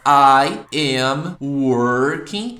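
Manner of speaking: slow, loud I am working, dragged out; teacher showing the wrong form slowly; wrong example. Shown as a demonstration. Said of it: "Eu não posso falar".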